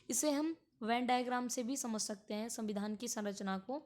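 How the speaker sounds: normal talking voice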